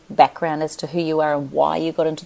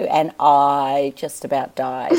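ordinary talk

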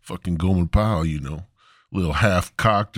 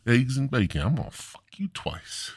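ordinary talk, nothing marked

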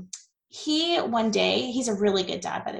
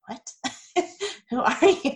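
laughing mock surprise; 8 semitones higher than usual. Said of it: "He was like".

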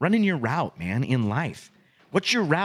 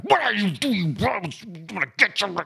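higher-pitched mock scolding yell; yelling at dog; 8 semitones higher than usual. Owner catching the dog piddling, mock yelling. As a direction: imitate an owner going after the dog. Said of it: "You're yelling at your dog".

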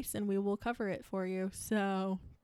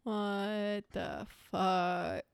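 slow drawn-out disbelief; 2 semitones lower, much slower than usual. Real, not acted. Stunned by the gruesome case.

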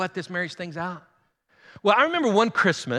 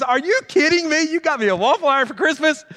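higher, mock-indignant wife's voice; mock disbelief; 9 semitones higher, 4 dB louder than usual. Wife to husband over waffle iron, mock disbelief. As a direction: play the let-down wife, aghast. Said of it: "She goes".

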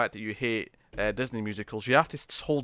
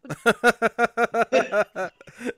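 loud, high run of laughter; helpless string of ha-ha-has; long laughing fit; 10 semitones higher, 6 dB louder than usual. Real, not acted. Laughing at the badly written number.

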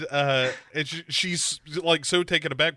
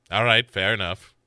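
low, conceding killer voice; imitating serial killer; 7 semitones lower than usual. Killer conceding to Sarah on the phone, low imitated voice. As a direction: drop low as the killer giving ground. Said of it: "he's like".

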